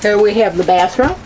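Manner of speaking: ordinary delivery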